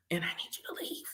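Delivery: hushed whisper; whispered plea to leave. Retold or imitated. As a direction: breathe it out secretly. Secret plea.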